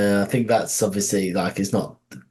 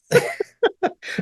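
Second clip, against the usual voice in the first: high squeaky laugh. Real, not acted. Laughs at spoiler joke.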